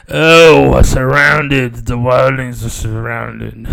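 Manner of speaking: slow, mock-epic battle voice; lightly voiced dramatic soldier, slowed down; Game of Thrones drama. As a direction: ham it up like a besieged warrior. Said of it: "like a scene of, uh, Game of Thrones".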